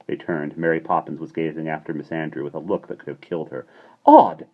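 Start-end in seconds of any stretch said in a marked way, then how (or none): 4.1-4.5 high, loud, sniffing disdain; scornful repeat. A narrator's reading. Mary Poppins repeating the insult with a sniff. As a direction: spit the word back with contempt. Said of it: "she repeated with a half-drawn sniff".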